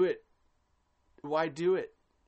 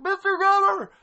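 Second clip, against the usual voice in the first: much higher, much louder strained yell; mock yell; 13 dB louder than usual. Character calling for the governor, hammed-up yell. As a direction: belt it out as if calling through your hands. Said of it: "he's yelling".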